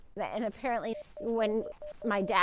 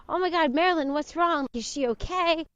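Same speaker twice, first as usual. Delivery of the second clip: higher, louder panicked questions; 5 semitones higher, 5 dB louder than usual. Retold or imitated. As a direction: mimic his alarmed fake concern. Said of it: "He freaks out".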